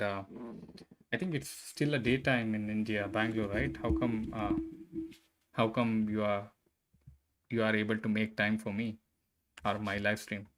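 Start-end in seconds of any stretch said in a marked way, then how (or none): none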